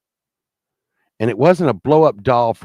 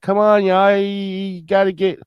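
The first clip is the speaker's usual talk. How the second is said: higher, louder urging; pleading call; 8 semitones higher, 4 dB louder than usual. Retold or imitated. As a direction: call out to hurry them along. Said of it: "I'm like".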